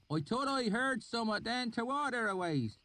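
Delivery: high rustic country voice; oily backwoods dialect; thick valley accent. A narrator's reading. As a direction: swineherd musing in a thick rural accent. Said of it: "his accent so thick and oily".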